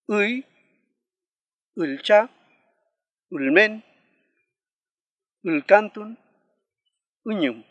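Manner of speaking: "Uy, ulcha, ulmen, ulcantun, uñum" loud, separated by long pauses; word-initial sixth vowel. Shown as a demonstration. Final review of initial vowel for learners, projected demonstration. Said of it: "Vamos a repetir una vez más".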